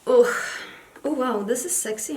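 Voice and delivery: normal talking voice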